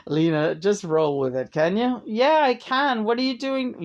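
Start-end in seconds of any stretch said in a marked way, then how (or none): none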